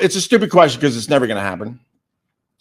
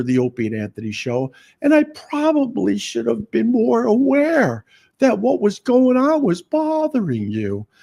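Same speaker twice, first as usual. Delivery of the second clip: mock apology; 9 semitones higher than usual. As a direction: lay on a sugary pretend sorry. Acting out his own apology.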